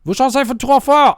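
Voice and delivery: high, slow, loud mock complaint; hammed-up scold; complaining listener. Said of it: "If somebody's like".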